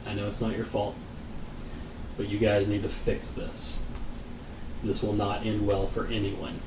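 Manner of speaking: low-key, softened plea to reason; quiet warning. Retold or imitated. Husband quietly warning the store kid, low-key and soft. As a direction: retell a discreet warning, softly.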